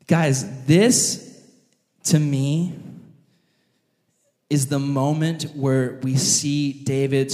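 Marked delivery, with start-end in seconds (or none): none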